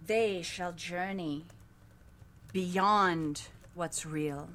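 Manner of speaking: slow, hushed oracle voice; slow oracle chant with long pauses; prophecy recital. Acted. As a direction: intone each phrase slowly.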